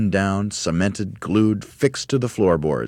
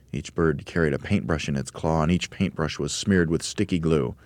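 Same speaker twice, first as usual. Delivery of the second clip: plain reading voice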